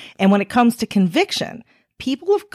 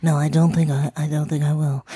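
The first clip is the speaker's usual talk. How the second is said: cartoon voice; 3 semitones lower than usual. Real, not acted. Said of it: "cartoon voice".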